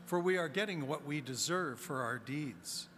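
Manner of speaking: usual speaking voice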